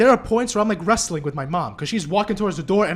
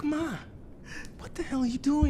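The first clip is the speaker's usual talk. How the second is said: high-pitched exasperated plea to mom; retold exasperation, pitched well up; exasperated protest; 9 semitones higher, 4 dB quieter than usual. Son to his mother heading outside, exasperated.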